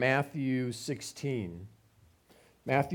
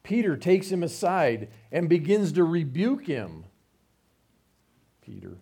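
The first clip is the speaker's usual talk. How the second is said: ordinary delivery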